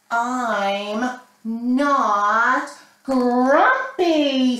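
loud, very slow, stretched shout. Acted. Jim shouts at his friends, loud and slow. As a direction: bellow it like a cross monkey. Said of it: "He shouted".

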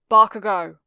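loud, sharp call of a name. A narrator's reading. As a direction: call the boy sharply to get his attention. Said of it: "Eraserhead was saying sharply".

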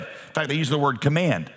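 usual speaking voice

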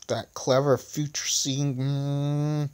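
soft, irritated muttering; angry mumble. A narrator's reading. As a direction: grumble crossly under the breath. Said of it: "mumbled Poet angrily".

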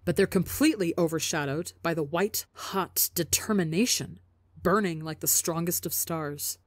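ordinary delivery, nothing marked

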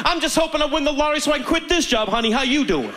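high-pitched stewardess impression, candid; imitating stewardess. Stewardess answering passenger's greeting, high candid imitation. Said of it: "And she goes".

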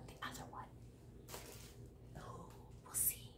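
soft secretive whisper. Real, not acted. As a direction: keep it hushed from the other room. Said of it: "Shh, she's here".

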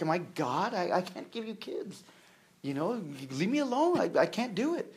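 fed-up husband pushing his wife away; frustrated husband. Retold or imitated. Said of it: "He's like".

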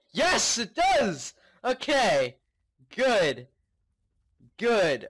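loud, slow triumphant yell; triumphant shout. Real, not acted. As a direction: cheer the win out loud. Health restarts, relief.

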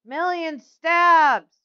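slow, gleeful announcement; proud, savoring call; drawn-out cheer. Real, not acted. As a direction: stretch it out in triumph. Celebrates the move by name.

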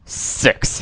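breathy hushed whisper; barely voiced inner count; captain counting losses under his breath; whispered thought. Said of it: "he thought".

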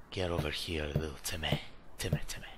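soft low sinister murmur; creepy coaxing. Pretend. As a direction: lure little Timmy out quietly.

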